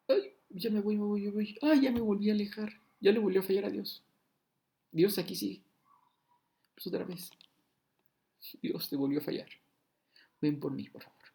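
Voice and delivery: voz aguda y lastimera de creyente que se aleja; quéjate como alguien que vuelve a fallar; creyente quejumbroso. Acted. Whiny believer voice. (creyente gimoteando, voz alta y lastimera)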